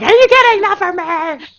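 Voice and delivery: high, loud mock outrage; indignant fan protest. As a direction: defend your favourite, all fired up. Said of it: "And I was like".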